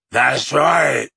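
lightly voiced werewolf; menacing werewolf. A narrator's reading. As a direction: savor the threat.

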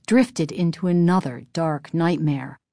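usual speaking voice

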